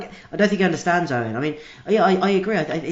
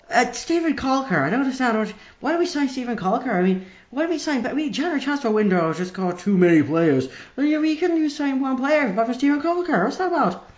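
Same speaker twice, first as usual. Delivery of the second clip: higher, baffled Northern Irish caller; Northern Irish accent; 5 semitones higher than usual. Acted. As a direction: rant about the signing in a Derry voice. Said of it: "the accent threw me slightly".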